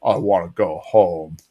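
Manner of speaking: low, slow put-on kid's voice; Dooley. Kid coming out of the closet, low slow imitation. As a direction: drop low and drag it out, imitating. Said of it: "Dooley's just like".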